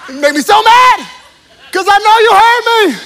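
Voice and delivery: high, loud indignant outburst; exasperated. Real, not acted. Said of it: "retelling his frustration".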